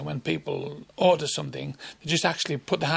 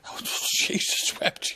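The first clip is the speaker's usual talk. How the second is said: laughing; 14 semitones higher, much slower than usual. Real, not acted.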